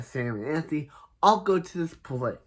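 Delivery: ordinary talk, nothing marked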